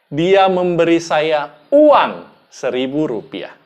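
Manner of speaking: slow down and punch "uang"; stress on "uang". Shown as a demonstration. Speech trainer demonstrating the money itself, stress on "uang". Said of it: "Anda bisa katakan".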